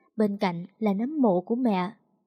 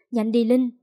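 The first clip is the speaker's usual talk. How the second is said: louder urgent push, stress on Linh; urging; 5 dB louder than usual. A narrator's reading. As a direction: hurry her on. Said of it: "Chú Năm thúc giục". (thúc giục)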